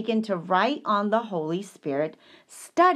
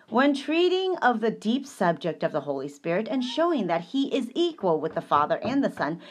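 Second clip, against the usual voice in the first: ordinary talk, nothing marked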